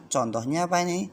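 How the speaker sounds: normal talking voice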